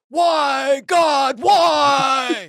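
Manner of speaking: high anguished shout to the heavens; Nicolas Cage wail. Retold or imitated. Nicolas Cage calming the staff with a high anguished wail. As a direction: wail it like a tortured movie hero. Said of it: "Nicolas Cage knows ... and goes".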